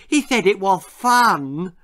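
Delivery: a hint of an excited lisping little girl; eager little sister, lightly lisped. A narrator's reading. Little Lizzie telling the boys, excited and lisping. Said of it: "lisped Lizzie excitedly".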